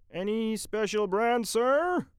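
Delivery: high, loud shop-clerk voice; store clerk. Acted. Clerk asking Mitty which biscuit, high and eager. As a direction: pipe up brightly with a rising question.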